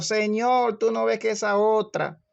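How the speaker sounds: high-pitched, indignant shouted complaint; complaining yell. Acted. Martha yells from the kitchen to the Lord, indignant shouted complaint. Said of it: "Marta le gritaba al Señor".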